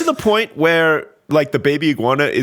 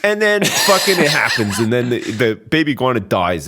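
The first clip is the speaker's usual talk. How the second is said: usual speaking voice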